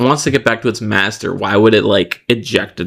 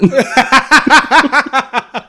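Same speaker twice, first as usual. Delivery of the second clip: high laugh; 14 semitones higher than usual. Real, not acted. Laughing at Yoshi comparison.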